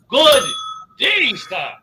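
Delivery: normal talking voice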